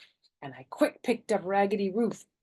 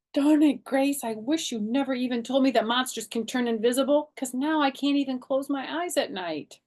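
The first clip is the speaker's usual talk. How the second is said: whiny complaint; 7 semitones higher, 5 dB louder than usual. A narrator's reading. Child complains to friend.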